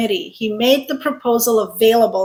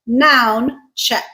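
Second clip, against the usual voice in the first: ordinary delivery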